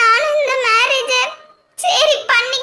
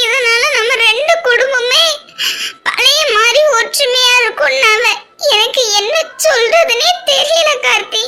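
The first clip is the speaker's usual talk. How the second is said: crying as she explains; tearful. Acted. Bride explains the family pressure to Karthi, crying. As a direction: let the voice crack with tears.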